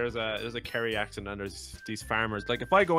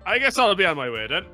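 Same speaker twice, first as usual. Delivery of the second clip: put-on Irish accent; 9 semitones higher, 12 dB louder than usual. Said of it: "Irish accent".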